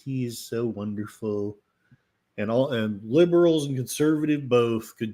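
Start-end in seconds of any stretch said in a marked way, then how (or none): none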